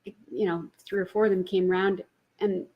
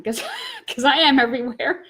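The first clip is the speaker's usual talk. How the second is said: laughing joy; 5 semitones higher, 7 dB louder than usual. Real, not acted. Joyful realization.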